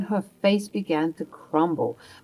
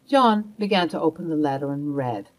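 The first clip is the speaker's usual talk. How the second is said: usual speaking voice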